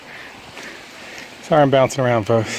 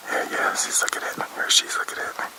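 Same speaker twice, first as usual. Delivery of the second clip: breathy hushed words near wildlife; whispering to viewers while filming a deer; 8 dB quieter than usual. Real, not acted. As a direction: whisper so the deer won't spook. Watching a deer up close.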